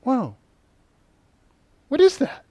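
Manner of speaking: high, rising startled exclamation; startled reaction to the accusation; startled whoa. Retold or imitated. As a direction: react like something shocked you. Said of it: "I was just like".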